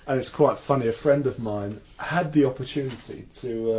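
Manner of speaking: normal talking voice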